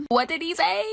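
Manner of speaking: high-pitched put-on disbelief, rising sharply; exaggerated incredulous high voice; mock shock. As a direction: squeal it as a joking scandalized question. Joking shocked reaction to driver's remark.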